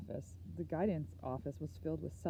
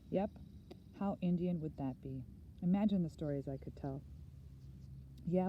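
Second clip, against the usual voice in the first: plain reading voice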